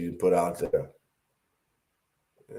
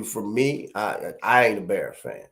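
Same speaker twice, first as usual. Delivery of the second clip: ordinary talk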